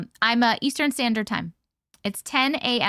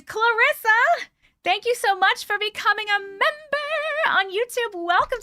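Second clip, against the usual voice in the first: delighted thanks; 10 semitones higher than usual. Real, not acted. Thanking a chatter for becoming a member, high and delighted. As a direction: call the name out with delight.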